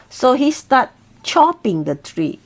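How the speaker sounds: ordinary delivery, nothing marked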